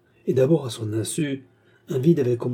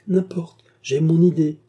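put-on higher, louder, spoiled-child insistence; playful, headstrong insistence pitched up; mischievously stubborn; 6 semitones higher, 6 dB louder than usual. A narrator's reading. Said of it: "avec la ténacité mutine d'un enfant gâté".

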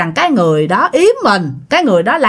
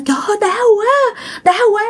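high pained wail; moaning in pain; 12 semitones higher than usual. Acted. Thắng, banging his head, crying out in pain. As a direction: groan it out, hurting badly. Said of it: "vừa la, vừa hét".

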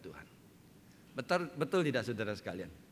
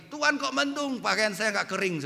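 high, loud grumble to God; hammed-up shout of grievance; mock yelled complaint; 9 semitones higher, 7 dB louder than usual. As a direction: call out in exaggerated protest. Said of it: "kita teriak lagi".

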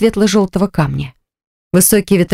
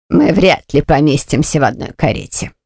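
cold aunt voice; 4 semitones lower than usual. Acted.

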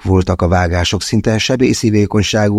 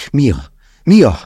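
calling out; 6 semitones higher than usual. A narrator's reading. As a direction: shake her and yell her name in panic. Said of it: "ráztam meg". (kétségbeesett kiáltás)

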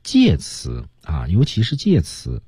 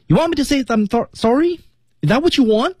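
high-pitched worked-up challenge; emotional demo. Shown as a demonstration. Coach demonstrates emotion for students, worked up. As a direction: pitch it way up, emotion driving the words. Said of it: "情感如果没有提升到一个高度".

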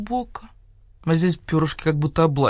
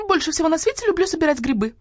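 high parroting copy of her line; bird mimicking her; 9 semitones higher, faster than usual. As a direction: echo her words, pitched high. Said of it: "повторил Скворец". (высоко повторяя её слова)

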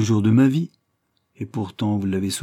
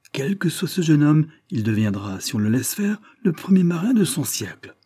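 higher, louder amazed cry; astonished exclamation; 7 semitones higher, 4 dB louder than usual. A narrator's reading. Examiner marvelling at the masked youth, loud amazed exclamation. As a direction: exclaim in stunned admiration. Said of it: "confondu s'écria".

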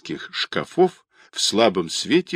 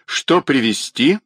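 ordinary delivery, nothing marked

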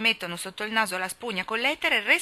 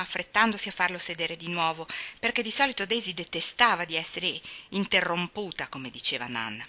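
plain reading voice

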